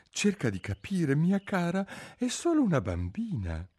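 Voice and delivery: timid, high-pitched pleading king. A narrator's reading. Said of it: "disse timidamente".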